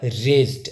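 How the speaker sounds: "रेज्ड" as one syllable, RAYZD; teacher's model of "रेज्ड"; pronunciation demo. Shown as a demonstration. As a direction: say "रेज्ड" with no extra vowel before d. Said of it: "यहाँ पे क्या लिखा हुआ है".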